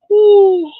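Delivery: loud, high yawning oh; a big real yawn on oh; oh stretched into a loud yawn. Real, not acted. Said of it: "I don't mean to yawn".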